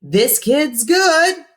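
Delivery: high, slow DJ with a smile. A narrator's reading. Cousin Ralphie praising the kid on air, smiling. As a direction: grin through the words. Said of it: "with a smile in his voice".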